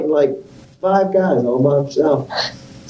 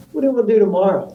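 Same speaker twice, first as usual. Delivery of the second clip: friend's cheerful question; 9 semitones higher, faster than usual. Retold or imitated. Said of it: "He goes".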